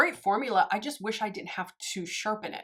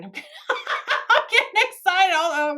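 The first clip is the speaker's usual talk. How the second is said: laughing through the words, higher and louder; excited laughing; 9 semitones higher, 8 dB louder than usual. Real, not acted. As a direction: giggle while saying it, bubbling over.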